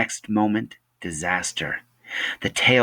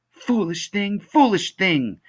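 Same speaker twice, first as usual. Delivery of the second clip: high, drawn-out repeated wail; grieving woman's high cry; stunned lament; 11 semitones higher, 4 dB louder, slower than usual. Acted. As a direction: keep lamenting, slower the second time. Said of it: "was all Missus Griffin seemed able to say".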